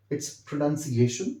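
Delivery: pronunciation stretched out very slowly; slow teacher model; slow model word. Shown as a demonstration. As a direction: say pronunciation deliberately for copying. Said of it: "So pronounce it as I told you".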